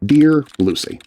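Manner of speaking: usual speaking voice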